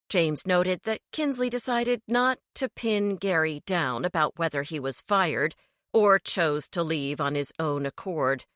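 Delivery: ordinary delivery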